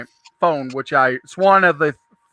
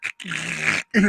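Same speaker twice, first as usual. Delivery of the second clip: softer mechanical whirring noise; mouth-made camera sound; imitated Polaroid camera; 2 semitones lower, 6 dB quieter than usual. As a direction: mimic an instant camera ejecting a picture. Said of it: "she had a Polaroid camera and went".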